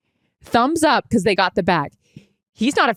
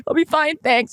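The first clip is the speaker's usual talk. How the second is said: high, put-on "I'll be fine, thanks"; dramatic brave face; 7 semitones higher than usual. Tearful woman answering the waitress, high and dramatic. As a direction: play it tragic and brave. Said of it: "so dramatic at this point".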